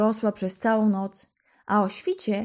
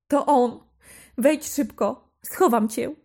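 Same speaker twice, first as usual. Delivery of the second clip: urgent panic; 4 semitones higher, 4 dB louder than usual. A narrator's reading. Wife hurries Jaś to hide.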